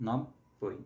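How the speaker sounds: নব-বই with a break between নব and বই; syllable-split demo. Shown as a demonstration. Teacher repeats নব-বই for students, split apart. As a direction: break নব-বই into two halves. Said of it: "আবার একটা হর্ষন্ত দেবো".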